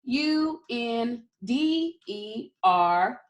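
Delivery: each letter set apart slowly; slow spelling. Shown as a demonstration. Teacher spelling the word slowly with the class. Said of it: "Let's spell it".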